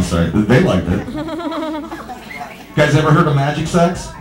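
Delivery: usual speaking voice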